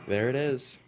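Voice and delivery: relieved cheer. Real, not acted. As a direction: let the relief burst out. Jump succeeds.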